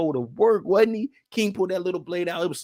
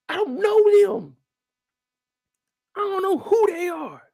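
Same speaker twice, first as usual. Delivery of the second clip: disown them with high-pitched contempt; indignant old man. Acted. Character denies the nephews.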